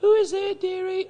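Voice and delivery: put-on an old lady calling through the door to the visitor. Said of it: "an old lady's voice say".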